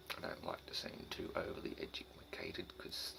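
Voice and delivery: soft unvoiced whisper. Real, not acted. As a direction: whisper it as an aside. Whispered aside.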